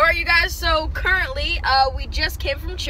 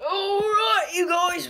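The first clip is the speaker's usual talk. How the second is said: higher-pitched mock Australian greeting; put-on Australian accent; 5 semitones higher than usual. Vlogger greeting viewers after a nap, put-on Australian accent. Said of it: "Australian accent".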